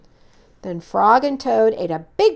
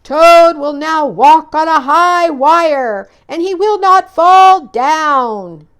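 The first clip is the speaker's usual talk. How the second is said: high, slow, loud announcer; booming showman's announcement; strange far-off voice; 6 semitones higher, 10 dB louder than usual. Acted. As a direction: announce it grandly from afar. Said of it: "said the voice".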